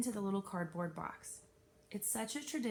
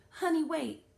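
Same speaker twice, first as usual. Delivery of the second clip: librarian's call; 8 semitones higher, 7 dB louder than usual. A narrator's reading. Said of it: "Missus Jones called".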